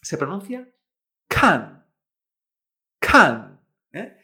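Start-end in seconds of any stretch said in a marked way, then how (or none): 1.3-1.8 kan loud, falling sharply, with a puff of air; fourth tone, aspirated. Shown as a demonstration. Teacher modelling the example, kan in an aspirated falling tone. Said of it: "K A N en cuarto tono". 3.0-3.6 kan loud, falling sharply, with a puff of air; fourth tone, aspirated. Shown as a demonstration. Teacher modelling the example, kan in an aspirated falling tone. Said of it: "K A N en cuarto tono".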